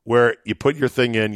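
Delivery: ordinary talk, nothing marked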